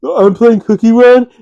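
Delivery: put-on dismissive kid. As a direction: brush him off like a busy kid. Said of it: "that's how he talks".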